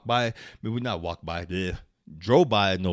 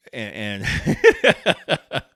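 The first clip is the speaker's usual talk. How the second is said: ordinary delivery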